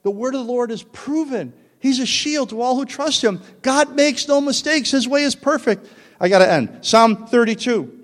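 high, loud, ringing declaration of scripture; full-force preaching. Real, not acted. Preacher closes on God's perfect way, preaching at full force. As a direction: proclaim each line with rising fervour.

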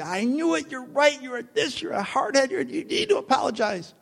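high-pitched nagging imitation of a wife; imitated scolding wife. Preacher predicts his wife embarrassing him, in high-pitched mock scolding. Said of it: "She's gonna embarrass me".

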